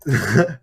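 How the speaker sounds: laughing in disbelief; amazed laugh. Real, not acted. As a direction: laugh at the absurdity. Laughing at the talking Excel.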